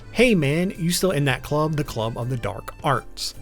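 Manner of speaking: normal talking voice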